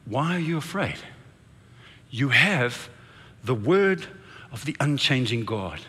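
soft, low, with long pauses; solemn reassurance. Acted. Jesus promising the frightened disciples, solemn reassurance.

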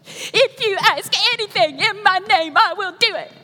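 put-on high, loud child voice, eager to repeat; little girl reciting again. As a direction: play the little Southern girl happily saying it again. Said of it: "And she goes".

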